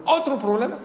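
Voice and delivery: high, startled question; surprised rabbi. Acted. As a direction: react with disbelief at another problem. Rabino sorprendido por nuevo problema. (rabino sorprendido, voz aguda)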